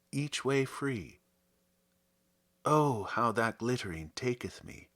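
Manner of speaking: measured, lyrical poetry reading; poem reading. Acted. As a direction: read it like verse for a listener. Reads a Herrick poem aloud.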